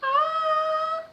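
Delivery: loud, very high sustained "ah"; high head voice. Shown as a demonstration. Teacher shows where high voice vibrates, high loud head tone. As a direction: ring out a high held note. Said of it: "E a voz aguda".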